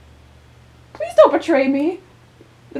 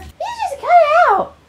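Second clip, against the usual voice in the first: higher, louder put-on scolding voice; a shrill-free, lifted scold; scolding character voice; 13 semitones higher, 5 dB louder than usual. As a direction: tell them off in a character voice.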